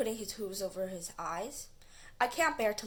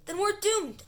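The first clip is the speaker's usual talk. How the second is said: doomed panic; 11 semitones higher, 6 dB louder than usual. Acted. Rushing steamrollers about to hit.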